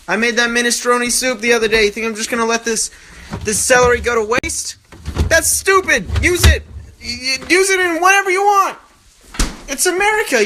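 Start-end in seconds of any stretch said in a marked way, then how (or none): 3.4-10.4 worked-up rant. Acted. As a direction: preach it like a patriot. Mock rant about wasting celery.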